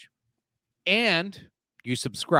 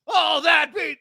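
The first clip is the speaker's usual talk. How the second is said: yell; 6 dB louder, slower than usual. Real, not acted. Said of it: "no, I can't yell".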